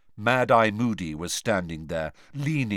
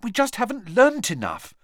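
Hermione voice; 12 semitones higher, 4 dB louder than usual. A narrator's reading.

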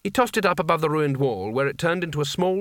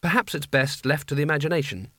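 ordinary delivery

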